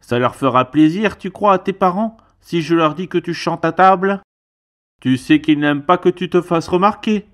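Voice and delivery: stern uncle telling off his nephew, loud and high; uncle scolding. Acted. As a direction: scold the boy for singing at the table. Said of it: "Son oncle lui dit".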